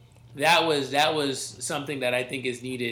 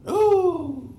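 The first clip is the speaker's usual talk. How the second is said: high hooting ooh; excited ooh; 3 dB louder than usual. Real, not acted. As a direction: hoot in delight. Reacting to heel turn.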